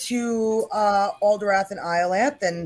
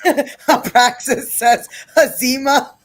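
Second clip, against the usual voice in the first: laughing; 3 semitones higher, 6 dB louder, slower than usual. Real, not acted. GM laughs at joke.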